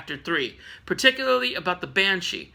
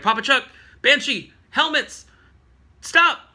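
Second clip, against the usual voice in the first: urgent mind message; 7 semitones higher, 5 dB louder than usual. Retold or imitated.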